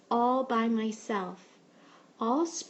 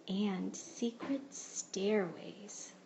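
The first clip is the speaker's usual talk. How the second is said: slow secretive whisper; 3 semitones lower, 6 dB quieter, much slower than usual. A narrator's reading. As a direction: breathe it like a secret, slowly. Mysterious secret stairways.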